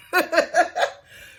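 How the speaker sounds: high amused cackle; real laugh while telling the story; high cackling laugh. Real, not acted.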